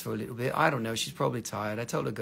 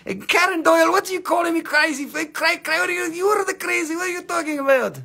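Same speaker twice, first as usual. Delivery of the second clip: high-pitched mock outrage, stammering "Cra-, cra-"; mock flustered viewer; 10 semitones higher, 3 dB louder than usual. Host imitating a viewer calling him crazy, high mock outrage. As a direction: squeak it back like a flustered viewer. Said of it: "they are like".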